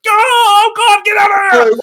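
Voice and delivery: very high, loud panicked yell; freaked-out scream. Acted. Guard who never saw an emu, freaked-out shriek. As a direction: scream like someone terrified of a strange beast. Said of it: "clearly freaked out".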